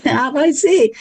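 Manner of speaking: ordinary talk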